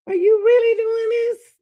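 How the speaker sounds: high, loud shocked outburst; stunned, almost yelling at the screen; disbelieving cry. Real, not acted. Reacting to the video, a loud shocked outburst.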